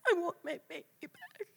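high, strained cry of loss; a mother's anguished plea, high and strained. Retold or imitated. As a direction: cry out for the lost baby, voice breaking high. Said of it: "screamed at the top of my lungs".

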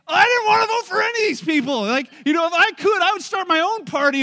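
loud, high, crying yell of a senior; retold yelling. Senior at the back of the line yelling across at the speaker, furious shout. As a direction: belt it out like an outraged old voter. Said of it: "starts yelling at me across".

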